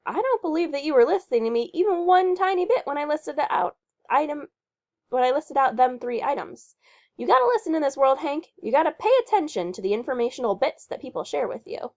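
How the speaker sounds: high, fast, bossy scolding; scolding Patty Lamarck. Acted. Patty scolding Hank for not listening, high and fast. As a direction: scold him quickly like a bossy know-it-all.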